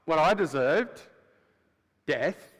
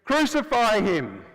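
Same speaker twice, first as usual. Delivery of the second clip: crowd yelling; 6 semitones higher, 5 dB louder, slower than usual. Retold or imitated. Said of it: "They said".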